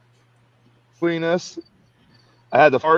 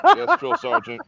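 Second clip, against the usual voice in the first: recruit's reply; 10 semitones higher than usual. Retold or imitated. Recruit answering drill sergeant.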